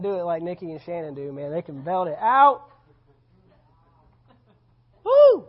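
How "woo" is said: high whoop. Pretend. Belting whoop.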